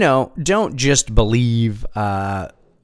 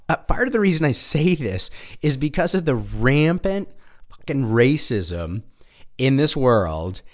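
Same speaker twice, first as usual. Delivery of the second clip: normal talking voice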